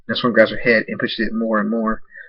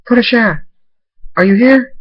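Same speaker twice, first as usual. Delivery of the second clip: a much higher, louder frantic call; screaming; 13 semitones higher, 9 dB louder than usual. Acted. A pony finding the ruined cottage, screaming high and loud. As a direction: scream her name in panic. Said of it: "she screams".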